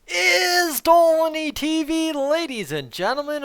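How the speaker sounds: big hyped-up welcome to the show; announcer intro. Pretend. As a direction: stretch out the show name like an arena announcer. Show opening, announcer-style welcome.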